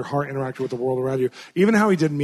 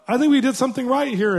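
much higher, louder mom voice; the mom doubting the reconciliation; mother's doubt; 9 semitones higher, 3 dB louder than usual. Retold or imitated. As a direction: voice the skeptical mom up high. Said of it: "The mom's like".

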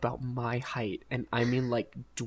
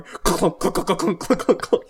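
higher, louder rhythmic clunks of a stuck handle; imitated door clunks; 7 semitones higher, 8 dB louder than usual. Drunk Jack yanking the minivan door, loud imitated clunks. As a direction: bang out the noise of a jammed door handle.

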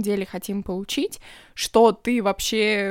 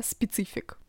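"специфик" in a heavy Russian accent, hard consonants; English "specific" read the Russian way, "специфик"; 10 dB quieter than usual. Shown as a demonstration. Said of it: "давайте я буду говорить её с русским акцентом".

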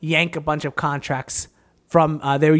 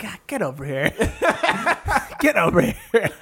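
goofy Bush impression; 3 semitones higher than usual. Imitating Bush, with a laugh.

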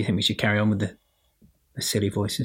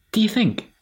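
co-host voice; 11 semitones higher, 4 dB louder than usual. Pretend. Pretend co-host asks host.

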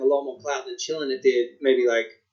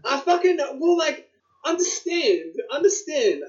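raised, joking voice; high mock protest; 11 semitones higher than usual. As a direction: protest in a high playful pitch. Mock-defending his academic talk.

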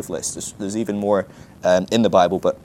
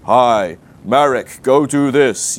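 louder, mock booming divine command; clear out loud boom; 6 dB louder, much slower than usual. Preacher mimicking the loud God voice people expect, booming. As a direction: announce it like a voice from above. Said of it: "a nice, clear, out loud boom".

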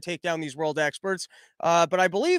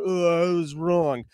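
a slow, lower hint of the Yellen voice; mock Yellen; 3 semitones lower, slower than usual. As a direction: drag out the apology in her voice. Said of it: "she turns around".

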